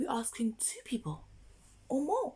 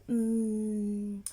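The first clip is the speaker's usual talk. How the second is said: ordinary delivery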